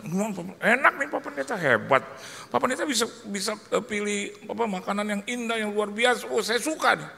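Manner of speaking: high, excited praise, imitated; delighted friends. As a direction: gush in a high mimicked voice. Teman memuji pilihan makanan.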